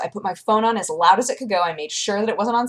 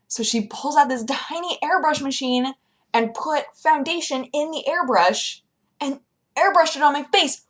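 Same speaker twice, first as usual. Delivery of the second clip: ordinary talk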